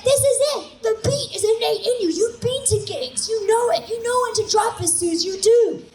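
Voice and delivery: high, loud, eager cheering voice; eager puppy hype men. Retold or imitated. The boys cheering Suze on like puppies, high and loud. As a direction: gush like an overexcited puppy. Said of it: "they're like".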